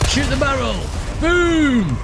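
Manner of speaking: high, excited call-out; excited shout. Real, not acted. Gamer blowing up a barrel, shouting excitedly. Calling out an explosion in game.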